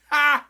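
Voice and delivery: one sharp loud high "Ha!"; gloating laugh bark. Real, not acted. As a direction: bark a single mocking laugh. Gloating at United's loss.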